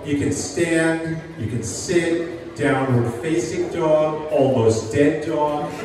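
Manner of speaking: usual speaking voice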